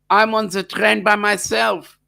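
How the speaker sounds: normal talking voice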